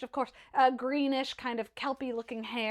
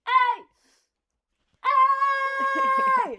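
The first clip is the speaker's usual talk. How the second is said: higher and louder long shout; 13 semitones higher, 8 dB louder than usual. Acted. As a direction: bellow up at the ship.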